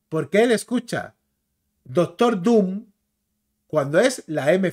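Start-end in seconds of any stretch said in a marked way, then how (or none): 1.8-2.9 DOCTOR DOOM with DOOM drawn out on its M; lengthened final M. Shown as a demonstration. Showing viewers English "Doctor Doom", long final m. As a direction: stretch "Doctor Doom" slowly, holding the final m. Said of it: "hay que alargarla".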